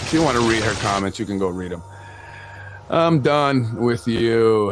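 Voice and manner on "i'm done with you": slow dismissal. Real, not acted.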